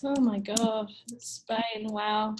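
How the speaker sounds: normal talking voice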